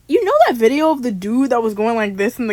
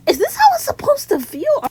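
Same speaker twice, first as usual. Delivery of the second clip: much higher, crying out in confusion; confused high cry. Retold or imitated. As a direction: squeal the question in disbelief. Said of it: "his mouth was somewhere else".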